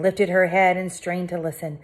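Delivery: ordinary delivery, nothing marked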